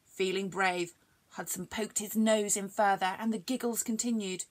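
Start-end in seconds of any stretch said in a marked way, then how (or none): none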